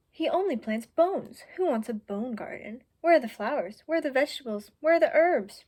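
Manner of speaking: a sly fox stirring trouble, building to a shout; scornful stranger. Acted. Stranger mocking the dog's bone garden to Mouse, scornful and building.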